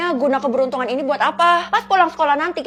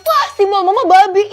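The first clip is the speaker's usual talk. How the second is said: higher and louder with a put-on voice; delighted exclaim; 5 semitones higher, 6 dB louder than usual. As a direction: show it off proudly. Mother delighted by her symbol.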